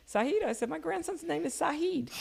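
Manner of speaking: high, excited retold discovery; surprised recognition. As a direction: exclaim the coincidence up high. Retold surprise at a shared name.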